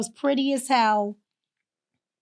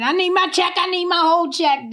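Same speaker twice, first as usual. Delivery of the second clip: higher-pitched mimicked nagging; retold pushy, raised-pitch demand; insistent demand; 7 semitones higher, 3 dB louder than usual. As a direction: imitate someone pestering for money. Imitates friend demanding money at dawn.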